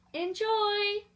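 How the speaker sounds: high, loud call across the field. Acted. Librarian calls to the children as he leaves, loud and high. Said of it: "he calls to the children".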